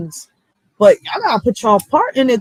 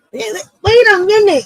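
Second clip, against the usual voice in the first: higher, louder call to the truck; 8 semitones higher, 4 dB louder than usual. Retold or imitated. Retelling a call to the trash truck crew, raised call. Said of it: "I asked the trash truck people".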